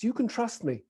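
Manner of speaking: lovely, believable British accent. Shown as a demonstration. Speaker demonstrating what works in Scandinavia, British accent. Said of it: "this lovely British accent that I have".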